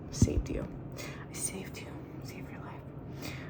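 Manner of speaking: soft whisper to a rescued jar; hushed, breathy rescue whisper. Real, not acted.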